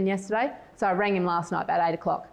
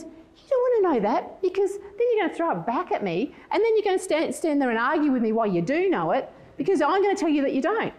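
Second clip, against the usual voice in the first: high-pitched imitation of a bickering husband; argumentative husband; 8 semitones higher than usual. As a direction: argue it out, pitch swooping high. Acting husband's side of argument.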